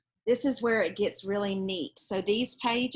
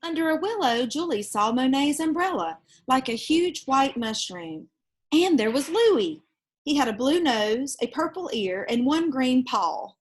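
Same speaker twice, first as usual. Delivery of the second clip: put-on lively read-aloud voice for children; storybook reading; 2 semitones higher, 5 dB louder than usual. Reading a picture book aloud to children, lively storytelling. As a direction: tell it like a bedtime picture book.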